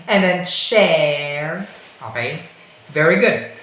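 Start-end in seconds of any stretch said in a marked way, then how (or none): 0.1-1.7 "share" drawn out slowly with an air glide; very slow careful "share"; slow demo. Shown as a demonstration. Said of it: "Say like that".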